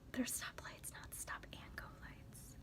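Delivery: hushed correction, slow; whisper. Real, not acted. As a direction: breathe the correction softly.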